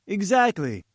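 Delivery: eager cry. A narrator's reading. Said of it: "cried Malone".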